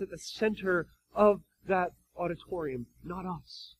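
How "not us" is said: ordinary talk, nothing marked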